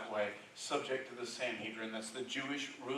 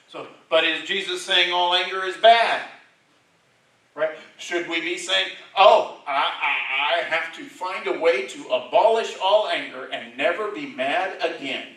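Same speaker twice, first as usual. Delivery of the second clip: loud, raised preacher's voice quoting scripture; preaching at full force; 6 semitones higher, 15 dB louder than usual. Acted. Preacher reading Jesus' words to the congregation, booming. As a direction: proclaim it to the back pews.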